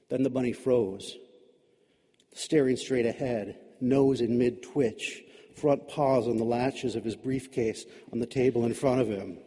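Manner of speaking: ordinary delivery, nothing marked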